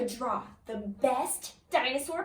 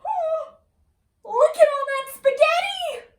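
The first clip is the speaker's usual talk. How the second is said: high, loud amazed exclaim; 13 semitones higher, 10 dB louder than usual. Acted. Reader marvelling at all the spaghetti, high and loud. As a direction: gasp at the pile of spaghetti.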